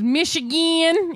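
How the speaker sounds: put-on Michigan accent, vowels leaned on and stretched; heavy Michigan accent. Mimicking the governor on the radio, heavy Michigan accent. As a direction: imitate a very pronounced Michigan accent. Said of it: "She has a very heavy Michigan accent".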